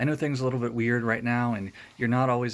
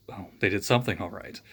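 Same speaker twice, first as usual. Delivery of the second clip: ordinary delivery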